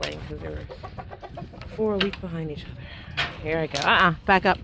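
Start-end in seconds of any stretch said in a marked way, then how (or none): 3.8-4.6 loud sharp order to dog; firm command. Real, not acted. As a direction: tell the dog back up firmly.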